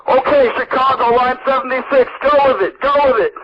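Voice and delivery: yelling in the background. Retold or imitated. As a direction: bark the orders like a frantic newsroom boss. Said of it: "I'm yelling in the background".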